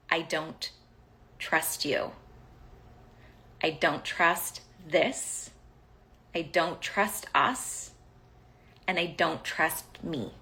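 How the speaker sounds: halting and deliberate, long pauses between phrases; measured, spaced-out delivery; slow, weighty confession. Retold or imitated. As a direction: let each admission land separately. Voices her unspoken message, slowly.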